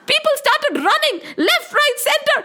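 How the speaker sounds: high, fast, frantic storytelling; urgent high-pitched alarm; panicked narration. A narrator's reading. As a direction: tell it as if fleeing in terror. Narrating a panicked crowd fleeing.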